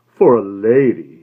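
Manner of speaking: slow, drawn-out insinuation; sly knowing. A narrator's reading. Florist hinting to the woman, slow and sly. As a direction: let it hang meaningfully.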